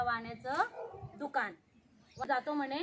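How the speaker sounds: ordinary delivery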